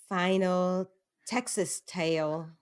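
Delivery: slow, stretched Texas twang; lightly put-on Southern accent; Southern drawl. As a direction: drawl it out like a Texan. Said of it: "I like how you said that".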